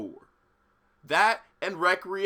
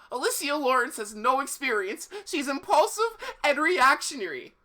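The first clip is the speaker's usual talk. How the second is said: fast, loud, higher mayor rant; ranting young mayor; 10 semitones higher, 6 dB louder than usual. Acted. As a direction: complain hurriedly like a flustered mayor.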